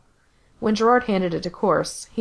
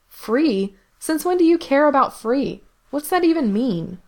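higher, louder disbelieving retort; Gerard incredulous; 8 semitones higher, 3 dB louder than usual. Acted. Gerard challenges Mikey's word free, louder and higher. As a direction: fire the question back, pitched up.

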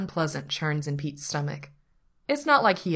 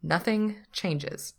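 usual speaking voice

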